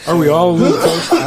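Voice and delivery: high-pitched laugh through words. Real, not acted. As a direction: laugh as you ask.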